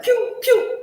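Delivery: high, loud mock gunfire; imitated gunshots. Viewer imitating the widow picking off husbands, mock gunshots. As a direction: squeal out cartoon gun noises. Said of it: "She was like".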